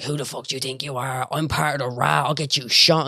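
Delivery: gruff mock threat from the addict; menacing addict. Addict threatening the group at the bus stop, mock menace. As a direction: voice the junkie's snarl, half acted.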